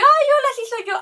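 greeting a follower on a motorbike, excited; bashful greeting. Retold or imitated. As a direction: greet her, flustered and pleased.

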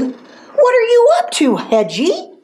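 high-pitched nosy pig voice; squeaky questioning sow; mother pig. A narrator's reading. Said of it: "the mother pig asked".

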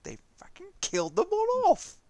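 loud, very high-pitched incredulous cry; retold shock, hammed up; outraged disbelief. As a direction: let the voice shoot up in mock outrage. Retold disbelief at series ending.

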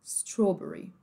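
"Strawberry" slowly, first vowel a closed oh; British closed vowel. Shown as a demonstration. Teacher repeating British, closed-O "Strawberry". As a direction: round the start of "Strawberry". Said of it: "Agora, britânico".

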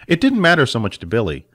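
usual speaking voice

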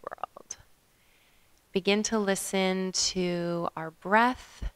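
calm meditation guide with long pauses; guided meditation. Acted. Yoga teacher guiding listeners to follow their breath, guided meditation. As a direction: lead them gently through the breath, leaving space.

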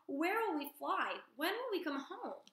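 worried gosling; young gosling. Acted. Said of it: "said Bright Bill".

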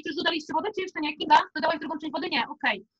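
ordinary talk

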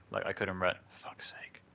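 whispered, annoyed muttering; muttered under breath. Real, not acted. Gamer cursing to himself after a failed move, whispered. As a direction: grumble it to yourself in a whisper.

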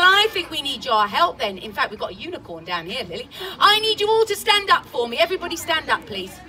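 usual speaking voice

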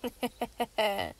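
a short, smug chuckle; villain chuckle. Acted. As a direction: laugh at your trapped victims. Villain laughs at his captives.

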